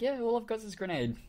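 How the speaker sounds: high-pitched. Retold or imitated. Own sheepish answer retold.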